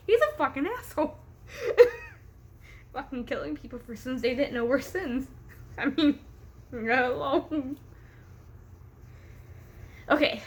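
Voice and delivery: ordinary delivery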